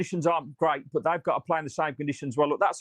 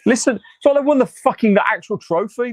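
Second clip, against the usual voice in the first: loud, high, furious outburst; furious rant; 6 semitones higher, 9 dB louder than usual. Real, not acted. Fan shouting at the stream over the team's loss, furious. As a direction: yell it out in disbelief.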